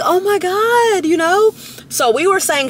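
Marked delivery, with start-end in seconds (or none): 0.0-1.0 slow, high gush of excitement; oh my God. Retold or imitated. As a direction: drag it out high, thrilled. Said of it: "And I was like".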